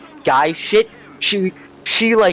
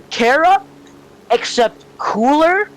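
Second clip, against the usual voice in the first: high and slow, gushing; sudden high excited praise; 12 semitones higher, 3 dB louder, slower than usual. Real, not acted. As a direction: rave about her, drawn out. S0 excited comparing friend.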